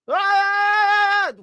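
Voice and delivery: high, loud mock wail of a prayer call. Mocking the call to prayer, a high loud wail.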